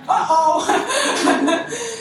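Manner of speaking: high, loud gasp then laughing uh-oh. Real, not acted. Speaker hearing a stranger fund her house, laughing gasp. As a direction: gasp in delighted disbelief. Woman pledges rent guarantee.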